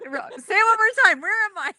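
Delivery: high laughing plea; excited. Real, not acted. Laughing at her own mispronunciation.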